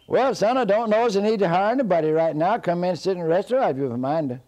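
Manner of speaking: high, loud holler; loud, high-pitched hollering to a visitor; called-out reply. Acted. Big man calls back to Jack.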